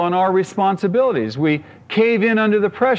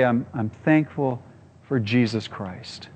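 quietly steeling himself; 5 semitones lower, 6 dB quieter, slower than usual. Retold or imitated. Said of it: "I've gotta steel myself".

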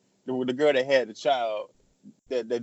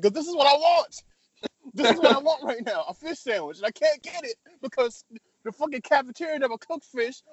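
higher, complaining rant about the cafeteria food; frustrated, hungry whining; whiny rant; 10 semitones higher than usual. Retold or imitated.

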